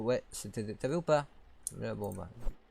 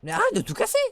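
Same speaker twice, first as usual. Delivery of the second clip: much higher, loud shout; exasperated yell; 10 dB louder, faster than usual. Real, not acted. Yelling at the baby breaking everything. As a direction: scold her at the top of your voice.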